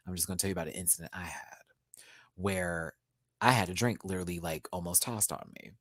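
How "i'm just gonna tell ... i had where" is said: fast confiding whisper. Real, not acted. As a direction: lean in and whisper it quickly. Confiding aside to viewers.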